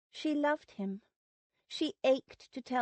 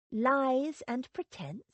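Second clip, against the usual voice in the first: ordinary delivery, nothing marked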